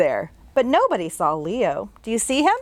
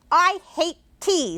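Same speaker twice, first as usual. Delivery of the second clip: put-on higher, slow sulky child voice; grumpy boy; 8 semitones higher than usual. Little boy in the bathroom sulking. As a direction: pout it out like a cross little boy. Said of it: "said the boy".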